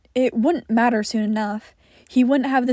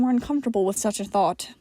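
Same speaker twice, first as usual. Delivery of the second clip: plain reading voice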